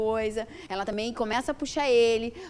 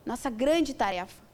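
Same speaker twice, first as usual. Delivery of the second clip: usual speaking voice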